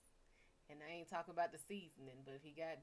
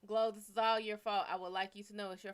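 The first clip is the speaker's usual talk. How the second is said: louder blame; 10 dB louder, faster than usual. Real, not acted. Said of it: "blaming Glow, louder".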